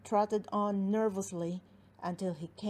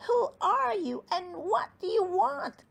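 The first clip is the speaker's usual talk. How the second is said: gnome voice; 10 semitones higher, 5 dB louder than usual. Acted. Gnomes question the giant.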